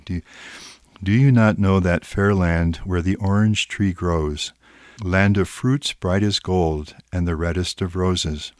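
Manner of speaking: ordinary delivery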